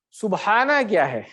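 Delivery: ordinary delivery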